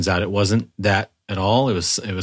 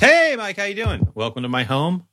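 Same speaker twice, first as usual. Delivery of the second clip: weird host voice; 8 semitones higher than usual. Acted. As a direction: greet him like a cheesy host. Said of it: "I don't know why I'm being weird".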